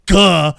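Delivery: a short, loud mock grunt for the car landing; imitated crash grunt. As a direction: bark out a cartoon crash noise. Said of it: "so he just kinda like".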